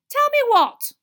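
very high, loud woman's question; sharp interruption. A narrator's reading. Mother interrupts from behind, sharp question. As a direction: cut in loudly, pitch right up.

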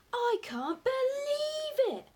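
high, drawn-out, overjoyed cry; thrilled and incredulous; elated disbelief. A narrator's reading. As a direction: savour the astonishment, loud and slow. Father elated after the haul.

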